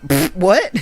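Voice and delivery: spluttered, high rising disbelief; incredulous reaction. Retold or imitated. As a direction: replay a scoffing double take. Retelling own incredulous reaction in cinema.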